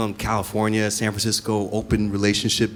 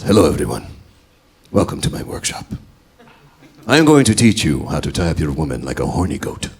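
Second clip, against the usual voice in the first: low, grand showman voice of a rope teacher; a loud, theatrical workshop instructor; flamboyant instructor voice; 3 semitones lower, 5 dB louder than usual. Retold or imitated. Instructor voiced in stand-up bit.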